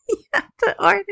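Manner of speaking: breaking into a high, loud laugh. Real, not acted. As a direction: laugh through the words. Laughs at earbuds going out.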